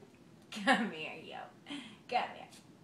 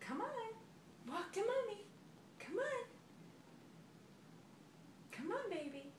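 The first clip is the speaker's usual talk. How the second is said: soft coaxing; 6 semitones higher, 9 dB quieter than usual. Real, not acted. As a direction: coax gently. Coaxing toddler softly.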